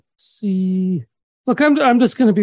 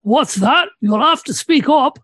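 put-on deaf old man voice; 2 semitones higher, 3 dB louder, slower than usual. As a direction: play the jolly deaf old man on request. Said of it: "deaf old man voice again?".

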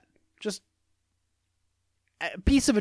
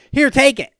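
high, loud mock offer; mocking quote; 7 semitones higher, 8 dB louder than usual. Man mocks the idea of handing over a car, loud and high. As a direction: throw the offer back in exasperation. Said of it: "You can't just say".

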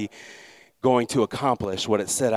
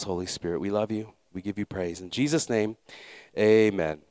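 lower, gentle praying voice; prayer; 4 semitones lower, 4 dB quieter than usual. Real, not acted. Leads congregation in prayer.

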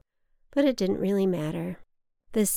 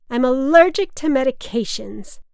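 loud, higher, slurred protest; drunken slur; 5 semitones higher, 11 dB louder than usual. A narrator's reading. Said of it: "my mother slurred".

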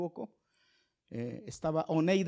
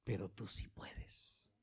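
hushed prayer; 3 semitones lower, 7 dB quieter, slower than usual. Retold or imitated. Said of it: "cuando le decimos".

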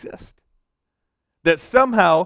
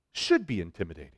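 ordinary talk, nothing marked

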